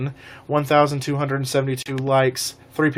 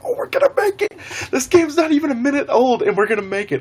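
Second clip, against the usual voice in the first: high, giddy mock celebration easing downward; excited retelling; 12 semitones higher than usual. Player thrilled to escape with gas and propeller, high excited re-enactment. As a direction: start in a squeal, come down gradually.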